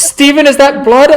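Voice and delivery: high, worried question; alarmed onlooker. Acted. Homeless person spotting blood on the rescuer, high worried question. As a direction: ask it high, as a frightened bystander. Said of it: "you hear one go".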